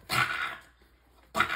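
sharp, loud burst imitating a cat's hit; imitated swat sound. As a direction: pop out a mock smack noise. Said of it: "that was like Bobcat hit, like".

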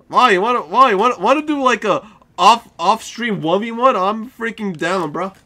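high, loud eager challenge; fired-up challenge. Real, not acted. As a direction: dare the opponent to play off stream. Challenging to off-stream match.

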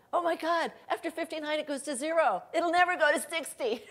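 high, loud dawning shock; excited realization. Retold or imitated. Man realizing the clock never reaches sixty, excited. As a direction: blurt it out as the truth hits.

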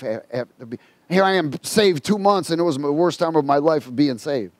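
ordinary talk, nothing marked

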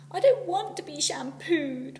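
high, loud, slow wail; boy in the bath wailing at his mother. A narrator's reading. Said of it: "wailed the boy in the bubbles".

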